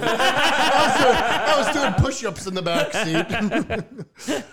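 slow laughing delivery. Real, not acted. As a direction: laugh it out slowly. Laughing through a joke.

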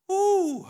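sudden high, loud "ooh" whoop; high preacher whoop. Acted. Preacher rousing the church with a high loud whoop. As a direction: let out a soaring whoop.

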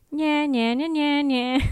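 high singsong mocking; childish taunt. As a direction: tease like a kid in the playground, acted. Acts Joyce's childish taunt.

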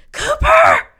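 very high, loud shriek; full-voiced, high-pitched mock scolding shout; mom yelling. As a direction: imitate an irate mother screaming at her son. Imitates mother yelling behind door.

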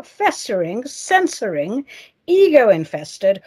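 normal talking voice

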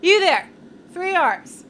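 loud imperious call. Acted. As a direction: bark across the deck. Calling out to crewman.

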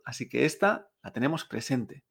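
ordinary delivery

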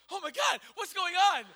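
high and loud, alarmed; startled wife woken by her husband at night; shocked wife. Retold or imitated.

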